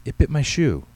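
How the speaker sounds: put-on loud, high boyish complaint; crying out. A narrator's reading. Boy showing his mother the proof, crying out. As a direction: protest like an indignant small boy. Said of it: "Tyler cried".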